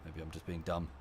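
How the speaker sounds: ordinary talk, nothing marked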